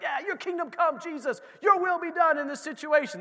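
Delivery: high, loud declaration over the situation; fired-up prayer. Pretend. Preacher voicing believers praying boldly, fired up. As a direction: proclaim it like you own the victory.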